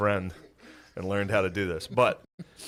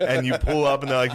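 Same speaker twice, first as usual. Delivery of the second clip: ordinary delivery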